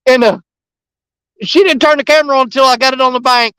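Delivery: ordinary delivery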